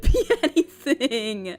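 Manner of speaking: slow, laughing through the line; amused helpless giggle. Real, not acted. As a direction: let a laugh break the sentence.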